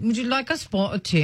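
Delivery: another go at a bad British accent; mock British accent. Shown as a demonstration. Radio host trying the British accent again to listeners. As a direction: play up the British accent, badly. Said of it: "I have an awful accent".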